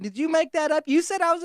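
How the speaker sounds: high, incredulous mock voice; challenging diner. Diner asking whether he made it up, high challenging voice. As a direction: pitch it way up, accusing.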